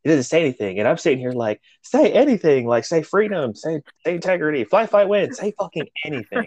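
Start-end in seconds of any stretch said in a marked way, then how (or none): 1.8-6.5 high-pitched mock pleading; will a stuck colleague to answer, pitch up; retold desperate urging. Silently begging Eric to answer.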